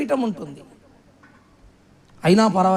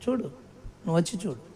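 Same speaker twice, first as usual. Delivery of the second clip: quiet; 4 semitones lower, 12 dB quieter than usual. Acted. Preacher repeats invitation softly.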